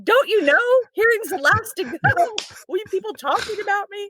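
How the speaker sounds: high, incredulous don't-you-know; squeaky mock outrage at the gossip; indignant inner protest. Injured patient silently scolding chattering nurses, high and incredulous.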